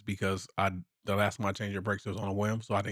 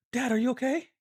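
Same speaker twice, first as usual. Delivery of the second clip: put-on higher, concerned child voice; father imitating daughter asking if he's okay; little daughter's voice; 13 semitones higher than usual. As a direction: play the worried kid checking on dad.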